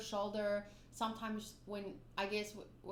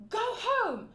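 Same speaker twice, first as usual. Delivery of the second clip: sharp yell, much higher and louder; shouted command; 12 semitones higher, 9 dB louder than usual. Retold or imitated. Big sister yelling at a charging dog to go home. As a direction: bark a command to scare off a dog. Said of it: "she screamed at it".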